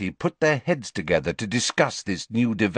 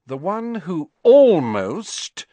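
almost drawn out slowly and loud; stress on almost; 9 semitones higher, 7 dB louder, slower than usual. A narrator's reading. Hermione to Parvati, stressing almost slowly. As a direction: lean hard on almost, slowly. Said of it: "she put a great deal of emphasis on the word".

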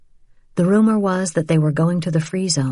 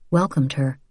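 ordinary delivery

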